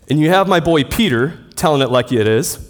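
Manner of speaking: ordinary talk, nothing marked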